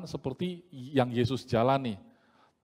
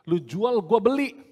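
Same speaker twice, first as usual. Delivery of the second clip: tough Medan challenge; 5 semitones higher, 5 dB louder, slower than usual. Retold or imitated. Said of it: "gaya orang Medan ya".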